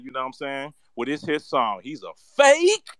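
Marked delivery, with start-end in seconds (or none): 1.8-2.9 drawn-out accusation. Acted. Denouncing candidate as fake.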